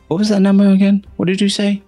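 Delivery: surprised. Acted. Bugbear startled by guess.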